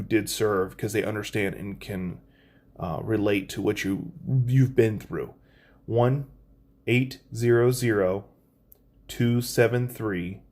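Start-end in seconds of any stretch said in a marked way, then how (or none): 5.8-10.4 slow, careful reading of the number; slow, deliberate digits. Real, not acted. Reading the hotline number slowly for viewers to note down. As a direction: give each digit time to sink in. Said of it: "I could have read it a specific way".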